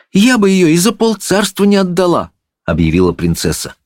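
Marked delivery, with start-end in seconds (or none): 0.1-2.3 put-on high girlish princess voice; high-pitched royal pronouncement; princess, declaring. A narrator's reading. Princess declares her cat priceless.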